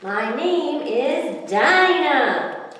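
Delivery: slow, high, drawn-out introduction; sweet fish voice. Acted. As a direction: sing out your name slowly. Fish introduces self slowly.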